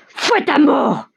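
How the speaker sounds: high, drawn-out brutal order; shouting. A narrator's reading. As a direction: cry it high, stretching each word. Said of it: "crie Mikolka". (cri haut et traînant)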